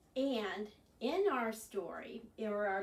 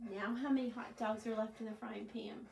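normal talking voice

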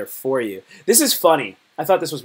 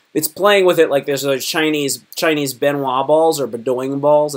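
ordinary talk, nothing marked

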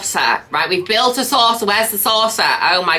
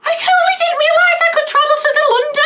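high, loud, fast shriek of delight, near crying; squealing excitement; 4 dB louder, faster than usual. Real, not acted. Gamer squeals on finding she can fly to London, delighted shriek. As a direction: squeal it like the best news ever.

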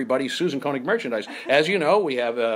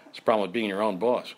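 ordinary talk

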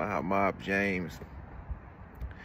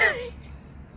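short high laugh; a real laugh; burst of laughter; 5 dB quieter than usual. Real, not acted. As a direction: laugh out at the punchline. Laughing at his own joke.

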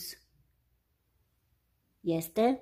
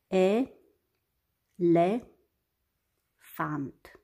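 "E, le, fant" as three isolated syllables with long pauses; despărțit în silabe; much slower than usual. Shown as a demonstration. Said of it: "Și despărțim în silabe". („E, le, fant” rostit pe silabe, cu pauze lungi)

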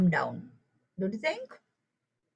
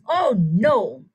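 put-on frustrated child; 2 semitones higher, 7 dB louder than usual. A narrator's reading. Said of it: "he stamps his feet and says".

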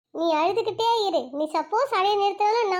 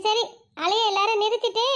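usual speaking voice

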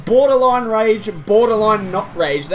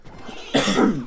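cough; 5 semitones lower than usual. Real, not acted. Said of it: "Excuse me".

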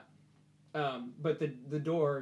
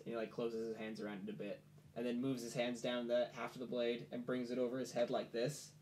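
ordinary talk

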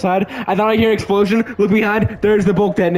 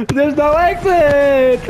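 slower high panicked shout; scared horror game streamer; 5 semitones higher, much slower than usual. Real, not acted. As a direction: repeat it, dragging in panic. Trapped, panicking.

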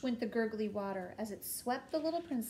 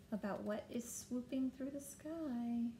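usual speaking voice

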